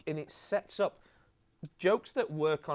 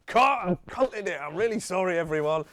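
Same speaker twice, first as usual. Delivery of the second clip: hammed-up actor blurting out his mistake; panicked apology; 5 semitones higher, 8 dB louder than usual. Ford ruins the Scorsese take, loud flustered mock apology. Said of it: "he goes".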